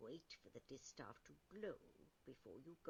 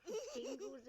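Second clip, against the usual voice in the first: laughing; 8 dB louder than usual. Real, not acted. Says it while laughing.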